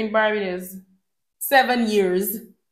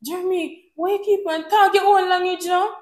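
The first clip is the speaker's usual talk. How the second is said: laughing, higher imitation of her husband's Jamaican accent; husband's mock Jamaican; 8 semitones higher than usual. Wife mimics husband trying Jamaican accent, laughing higher. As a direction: tease him in his put-on Jamaican accent, laughing. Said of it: "he wants to speak like a Jamaican".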